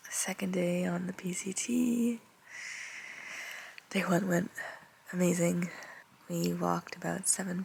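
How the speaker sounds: soft early-morning whisper. Real, not acted. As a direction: whisper so others sleep on. Whispering in tent at dawn.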